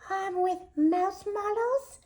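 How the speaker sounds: put-on high, slow little-girl plea; begging. A narrator's reading. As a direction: beg sweetly for a treat, drawn out. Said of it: "begged the girls".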